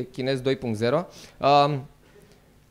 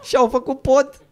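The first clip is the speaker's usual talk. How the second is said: mock drunk voice; 10 semitones higher, 6 dB louder, slower than usual. Drunk Dacians reacting.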